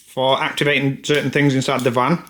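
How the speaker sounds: ordinary talk, nothing marked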